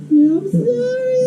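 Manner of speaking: high, slow, drawn-out fake bawling; mock wailing apology. Boy forced to apologize to the lady, mock wailing. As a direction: imitate a boy wailing like a silly ghost. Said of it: "He sounded like an idiot ghost".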